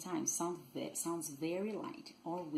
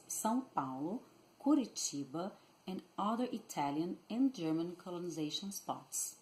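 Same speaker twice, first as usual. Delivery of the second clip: normal talking voice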